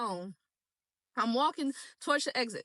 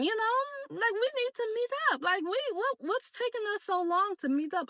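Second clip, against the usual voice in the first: much higher, fast and eager pitch; eager flirting; 6 semitones higher than usual. Retold or imitated. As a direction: gush like you can't wait to meet. Retold eager flirty message.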